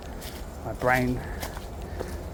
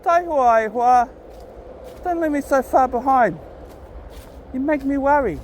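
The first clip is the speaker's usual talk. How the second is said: calling the dog; 9 semitones higher than usual. Real, not acted. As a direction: shout across the park so the dog comes back. Calling after his wandering dog.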